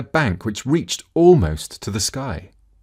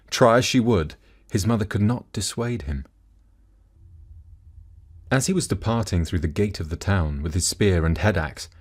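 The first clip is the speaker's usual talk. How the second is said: plain reading voice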